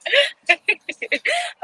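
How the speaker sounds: high burst of laughter; real laughter. Real, not acted. Laughing at own cheese joke, real helpless laughter. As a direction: crack up laughing.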